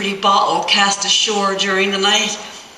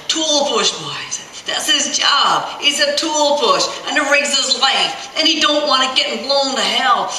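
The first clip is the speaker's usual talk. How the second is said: stern roughneck; 7 semitones higher than usual. Acted. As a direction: drive the warning home to greenhorns. Ben lecturing the kids.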